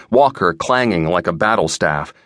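usual speaking voice

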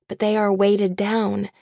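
usual speaking voice

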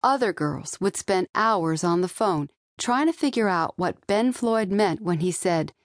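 ordinary delivery

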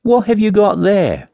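high, drawn-out question; curious miller. A narrator's reading. Miller noticing the wrapped raven, high curious question. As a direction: ask slowly, pitched right up. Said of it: "and he said".

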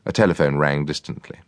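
usual speaking voice

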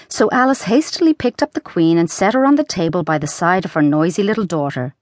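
plain reading voice

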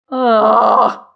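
loud, long groan of frustration; exasperated groan. A narrator's reading. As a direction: groan at a hopeless friend. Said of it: "surprised to see how exhausted he looked".

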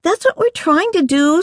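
put-on very high, exasperated girl; exasperated girlish whine. A narrator's reading. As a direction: protest in high-pitched exasperation. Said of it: "Sarai said in exasperation".

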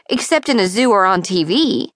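ordinary delivery, nothing marked

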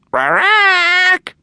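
loud high shriek of disgust; startled parrot squawk. A narrator's reading. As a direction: recoil with a sharp bird cry. Doris recoils from perfume.

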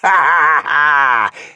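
loud, high villainous orc laugh; malevolent laugh. Acted. Orc chieftain gloating before his troops, malevolent laughter. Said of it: "He laughed malevolently".

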